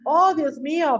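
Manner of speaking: teacher's demo of an amazed cry; exclaiming. Shown as a demonstration. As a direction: show the change of voice on an exclamation. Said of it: "Tiene que haber un cambio de voz".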